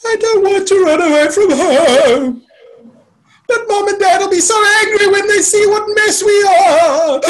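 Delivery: imitating a little kid sobbing; hammed-up crying. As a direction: bawl it out, ending in sobbing gasps. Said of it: "Nelly was crying, going".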